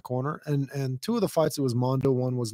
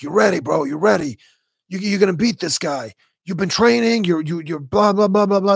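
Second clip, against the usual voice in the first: louder, higher mock pump-up speech; mock hype cornerman; 4 semitones higher, 5 dB louder than usual. Cornerman pumping up fighter before the fight, mock hype. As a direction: ham up a cornerman hyping his fighter. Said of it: "they're like".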